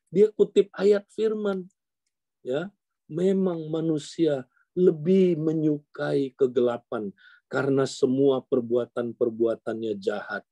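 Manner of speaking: ordinary talk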